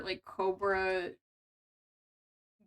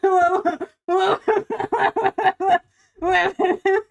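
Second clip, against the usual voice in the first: mock alarm; 8 semitones higher, 10 dB louder, much slower than usual. Shocked by sex toy requests.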